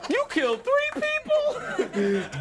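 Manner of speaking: high, loud, drawn-out question; stretched, shrieky incredulous question; shocked disbelief. Acted. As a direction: react in hammed-up horror. Acted villain reacting to cellmate's crimes.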